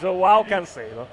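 slow, English-accented reading of the name; English-style pronunciation. Shown as a demonstration. Host repeating the guide's version, slow English accent. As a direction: draw the name out with English vowels. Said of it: "hanno messo la pronuncia".

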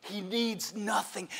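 normal talking voice

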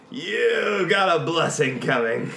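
slow, knowing promise. Acted. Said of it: "I'm like".

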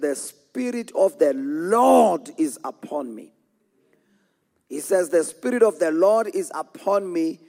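ringing pulpit recitation, building in pitch; preached scripture declaration. Real, not acted. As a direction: declare the verse to the congregation.

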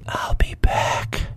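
slow, unvoiced whisper; close whisper. Agent leans close to hooded prisoner, slow secretive whisper. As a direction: whisper it right into someone's ear. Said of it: "get really close to the side of Carl's face".

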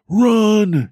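high, loud urgent cry; shouted warning. Acted. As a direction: yell at her to flee. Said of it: "he called".

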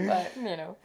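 ordinary talk, nothing marked

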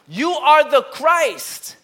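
Peter's awed declaration; declaring. Retold or imitated. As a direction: proclaim it with awe. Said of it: "his inflection in his voice was like that".

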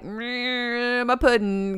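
mumbly celebrity impression with a drawn-out hum; Bill Cosby. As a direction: hum into a mock comedian's catchphrase. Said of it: "a real Cosby".

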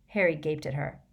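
ordinary delivery, nothing marked

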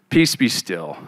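low, soft, unhurried rebuke; calm command. Retold or imitated. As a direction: still the storm with quiet authority. Jesus calms the storm.

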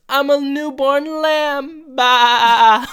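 high, slow, loud mock wail; hammed-up squeal. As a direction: stretch it out into a lamb's bleat. Retold defensive joke with bleat.

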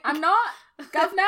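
put-on high, loud joke Cockney accent; mock Cockney. Host playing a Brit who thinks the accent is easy, mock Cockney. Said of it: "in a Cockney accent".

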